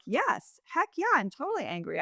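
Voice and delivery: an acted, indignant yes; emphatic agreement. Acting out someone owning their anger.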